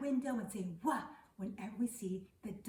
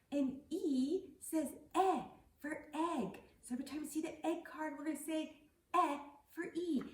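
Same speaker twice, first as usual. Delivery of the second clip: put-on reading to a toddler; 5 semitones higher than usual. Sing-song high teacher voice.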